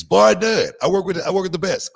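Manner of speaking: loud, high declaration; punched-out, high-pitched boast; emphatic insistence. Real, not acted. Insisting on his standing in the business.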